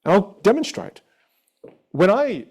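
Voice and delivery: normal talking voice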